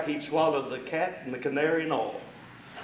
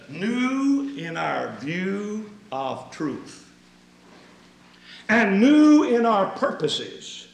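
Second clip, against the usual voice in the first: each new leaned on, slow and rising in force; emphatic quote stressing new; 5 semitones higher, 6 dB louder, slower than usual. Retold or imitated. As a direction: build the list, hammering every new. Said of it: "He said".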